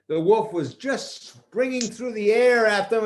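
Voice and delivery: usual speaking voice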